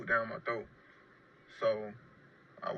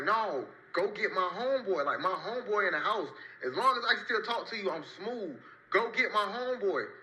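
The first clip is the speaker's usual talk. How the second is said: higher, pleading to go get his friend; desperate plea; 8 semitones higher than usual. Retold or imitated. Lying shot, urging paramedics to fetch his homeboy, higher desperate plea. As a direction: insist they save the friend first.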